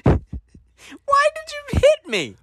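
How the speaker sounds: high, slow, drawn-out whine; mock whiny outrage. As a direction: whine about the slap like a hurt kid. Demanding why he was hit.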